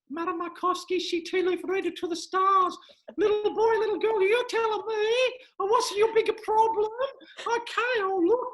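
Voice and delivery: hammed-up psychic reading a child's tea leaves; Madame Markovsky. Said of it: "the spirit of Madame Markovsky entering me now".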